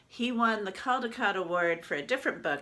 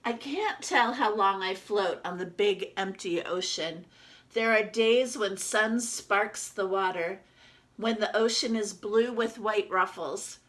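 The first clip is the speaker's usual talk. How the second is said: lively storybook reading as the duck; reading to children; 2 semitones higher, 5 dB louder than usual. Acted. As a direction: read it out to the class like a picture book. Teacher reads picture book aloud.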